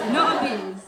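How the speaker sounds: ordinary delivery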